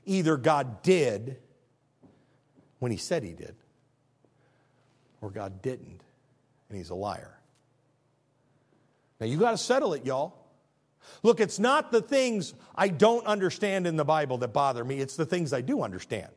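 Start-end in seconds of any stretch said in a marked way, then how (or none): none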